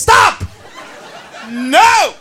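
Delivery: usual speaking voice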